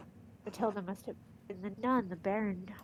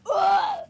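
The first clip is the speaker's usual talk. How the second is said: high, loud imitated groan of disgust; mock disgusted ugh; 9 dB louder than usual. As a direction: belt out a mock grossed-out ugh. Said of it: "everybody on the bike path was like".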